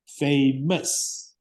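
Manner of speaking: "Famous" split into two beats, fay, mus; broken into syllables. Shown as a demonstration. Teacher counting syllables for a student, "Famous" in two separate beats. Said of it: "how many syllables does it have?".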